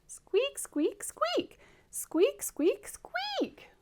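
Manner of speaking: put-on very high-pitched baby creature squeaks; tiny high squeals, rising on the last; squeaking. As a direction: squeak like something inside an egg. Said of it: "Can you do that like I did?".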